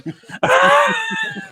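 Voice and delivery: high shrieking burst of laughter. Real, not acted. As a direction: cackle so hard it nearly cracks. Laughing after telling the punchline.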